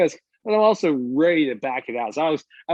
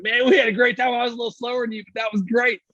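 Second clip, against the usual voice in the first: oblivious driver; 10 semitones higher than usual. Retold or imitated. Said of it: "He's like".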